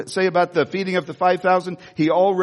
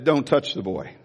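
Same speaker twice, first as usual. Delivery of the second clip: lower, solemn command from heaven; voice of God; 5 semitones lower, 3 dB quieter than usual. Retold or imitated. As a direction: say it low, as God stopping him. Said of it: "he said".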